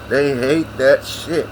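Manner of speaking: slow, stretched "They hate that shit"; slow drawn-out repeat. Shown as a demonstration. Grandson repeats the line to listeners, slowly drawn out. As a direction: drag out every word of "They hate that shit".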